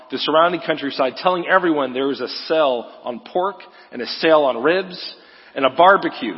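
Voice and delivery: usual speaking voice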